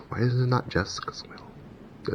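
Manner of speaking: slow, hushed whisper; whispered question to yourself; whispered aside. Real, not acted. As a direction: puzzle it over under your breath. Puzzled aside to himself.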